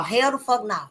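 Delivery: normal talking voice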